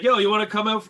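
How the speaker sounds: a friend calling him out to dinner; imitating buddy inviting him out; buddy's invite. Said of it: "he's like".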